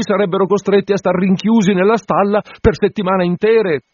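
voce più alta e forte, adulante; l'assistente adula il curato galoppando; assistente lusinghiero. A narrator's reading. (assistente adulatore, voce alta)

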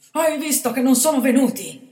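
loud high desperate cry; boy terrified in the ambush. A narrator's reading. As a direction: plead in despair. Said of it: "sussurrò con accento disperato".

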